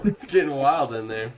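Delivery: talking through laughter; chuckling while speaking; laughing. Real, not acted. Group laughing at antics.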